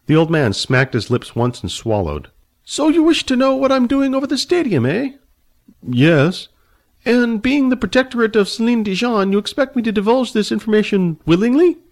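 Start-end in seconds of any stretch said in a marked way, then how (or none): 2.6-11.8 put-on high, fussy old man, haughty and annoyed. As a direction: play the prickly old dragon rider, voice pitched high. Old man questions Billy.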